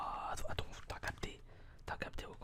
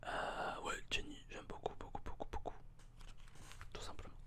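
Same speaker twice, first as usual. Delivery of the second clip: right in your ear; 8 semitones higher, 10 dB louder than usual. Real, not acted. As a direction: close whisper in the ear.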